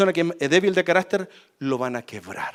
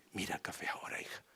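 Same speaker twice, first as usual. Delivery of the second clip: soft whispered mother; mother's whisper; 9 semitones lower, 22 dB quieter, faster than usual. Retold or imitated. Mother whispers to her daughter about the coffee.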